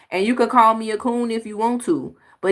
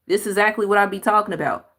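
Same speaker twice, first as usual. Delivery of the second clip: ordinary delivery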